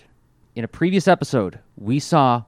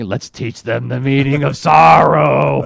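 loud, drawn-out villain proclamation; Dirge; 7 dB louder, slower than usual. Retold or imitated. Co-host quotes a Decepticon bombing the city, loud villain impression. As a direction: boom it out slowly like a cartoon villain. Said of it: "audio clip of Dirge saying".

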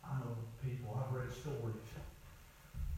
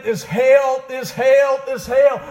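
loud, evenly hammered repeated cry; preached deathbed crying; 13 semitones higher, 13 dB louder, much slower than usual. Retold or imitated. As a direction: cry it out three times over. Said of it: "Don't be found crying at your deathbed".

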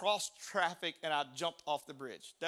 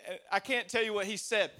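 usual speaking voice

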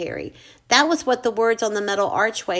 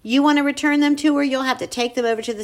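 fast, clipped brush-off; hurried; 4 semitones higher, faster than usual. Acted. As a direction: rush through it. Woman hurries him off.

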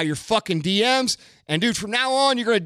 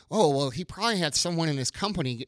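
mock skeptic; 4 semitones lower, 6 dB quieter, faster than usual. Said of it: "a lot of you guys are probably thinking".

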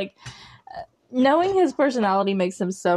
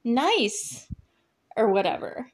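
mock halting pauses; 3 semitones higher than usual. Shown as a demonstration. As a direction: imitate teen-show talk, stranding each little phrase between long pauses. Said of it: "They, like, pause and then say".

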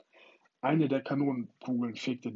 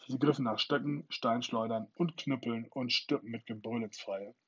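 plain reading voice

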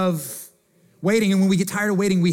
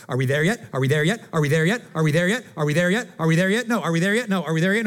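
put-on whiny kid in the back seat, rising pitch; pestering child; 3 semitones lower than usual. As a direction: nag like a bored child on a road trip. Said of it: "what does that look like in the car".

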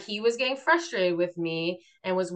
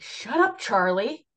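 mock scolding; 5 semitones higher, 3 dB louder, slower than usual. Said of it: "I was like".

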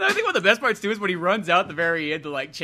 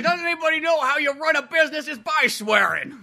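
high, put-on indignant voice; hammed-up outrage; mock angry New Yorker; 10 semitones higher than usual. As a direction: whine it like a cranky New York boss. Voicing Kaz complaint.

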